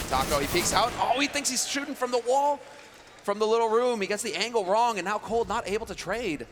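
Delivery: excited high play-by-play, loud; esports caster. Acted. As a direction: call the fight rising fast and loud. Live play-by-play of a fight.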